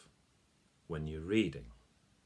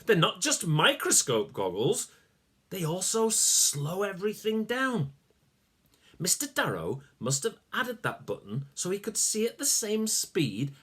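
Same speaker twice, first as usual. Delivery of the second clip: excited; 9 semitones higher than usual. Acted. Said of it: "Max nodded with excitement".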